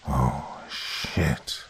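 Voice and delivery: low, hushed throaty curse; deep throaty whisper. A narrator's reading. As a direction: breathe it out low and rough. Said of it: "whispered in a deep, throaty voice".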